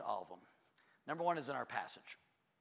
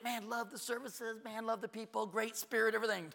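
imitated happy visitors; 9 semitones higher than usual. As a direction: put on an enthusiastic guest's voice. Imitates usual happy visitor responses.

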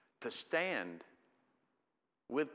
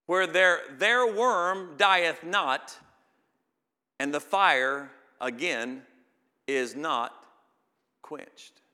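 higher, louder and slow, the words stretched out; preaching at full force; 4 semitones higher, 9 dB louder, much slower than usual. Acted. Preacher thundering the scripture about unquenched fire. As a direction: thunder it, leaning on every word.

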